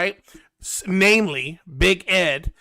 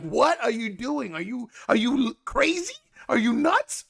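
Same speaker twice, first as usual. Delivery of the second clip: incredulous; 5 semitones higher than usual. Acted. Acted incredulous reaction to Liz.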